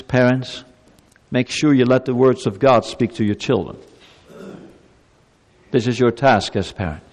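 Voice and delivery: usual speaking voice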